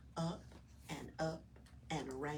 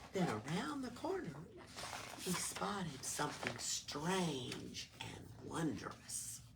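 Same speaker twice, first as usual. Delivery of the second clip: plain reading voice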